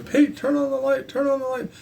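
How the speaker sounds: high, urgent calls for the light; frantic yelling. Retold or imitated. Yelling at brother to turn on the light, frantic. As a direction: yell for the brother to hit the switch. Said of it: "I was very frantic about it".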